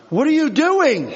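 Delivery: high, loud, incredulous yell at the driver; exasperated yell. Retold or imitated. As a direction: yell back at the honking car behind. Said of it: "And I'm like".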